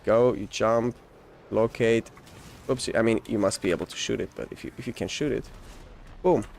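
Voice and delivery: ordinary talk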